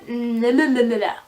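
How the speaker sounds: nonsense "la la la" after a stumble; flustered tongue-tripped babble. Real, not acted. Vlogger resetting after a flubbed line, flustered babbling. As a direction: babble away the fumbled words.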